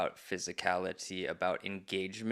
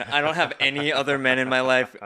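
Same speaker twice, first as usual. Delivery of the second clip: louder, higher mock plea; mock neediness; 6 semitones higher, 12 dB louder than usual. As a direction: plead loudly, playing it up for laughs. Joking plea to friend Adam.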